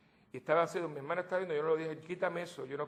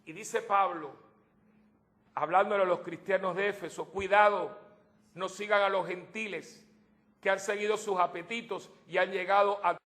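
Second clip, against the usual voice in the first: louder, higher preaching of Paul's warning; 4 semitones higher, 6 dB louder than usual. Acted. Preacher warning the faithful with Paul's words, full preaching. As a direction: raise the voice and warn the congregation.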